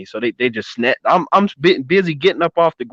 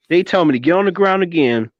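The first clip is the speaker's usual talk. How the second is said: ordinary delivery, nothing marked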